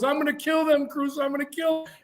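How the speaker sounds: high, contained imitation of screaming; retold screaming threat. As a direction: imitate a worked-up inmate in a high voice. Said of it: "he starts screaming".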